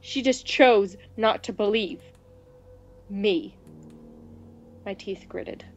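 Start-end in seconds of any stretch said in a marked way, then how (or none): none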